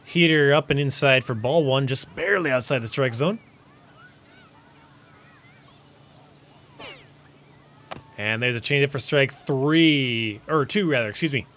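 lively baseball announcer call; sports play-by-play. Acted. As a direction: call the game like a broadcaster.

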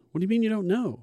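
incredulous retort. Retold or imitated.

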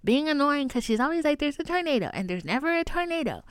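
high, gossipy put-on mother voice; mother on the phone. Mother relays to her friend about her annoying daughter, imitated complaint. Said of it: "relaying to her friend".

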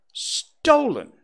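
high, loud indignant cry; shocked outcry, high then falling; cried out. A narrator's reading. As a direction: fling the word back, stunned. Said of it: "he cried".

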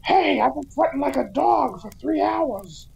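high-pitched comic costumed character; Booster's voice. Retold or imitated. Costumed Booster griping about sweating, high put-on voice. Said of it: "cut to Booster going".

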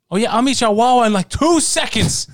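mock-eager boast shooting up high; hyped-up retort. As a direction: act out a cocky challenge, stretching the last words. Retelling his own eager challenge.

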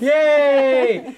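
high happy cheer; excited cheer. Real, not acted. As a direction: cheer her in. Host cheers.

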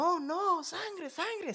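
very high, childlike mock panic; high-pitched hammed-up dismay; mock horrified reaction. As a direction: imitate an innocent boy aghast. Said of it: "tú no piensas que hubiera reaccionado".

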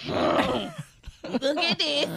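very slow, drawn-out flat reveal; mock casual reveal; deadpan show-off. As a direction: draw it out, totally unbothered. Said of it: "I did like this".

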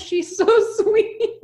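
high, laughing and gushing. Real, not acted. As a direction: melt with laughter at the sweetness. Touched by a sweet story.

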